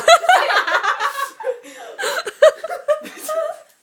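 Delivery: shrieking, crying laughter; high helpless laugh. Real, not acted. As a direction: lose it completely, laughing till tears. Breaks down reading story.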